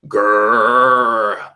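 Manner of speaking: low beastly growl; guttural growl. Acted. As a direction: growl like a wild animal. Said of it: "A guttural growl like that of a wild beast".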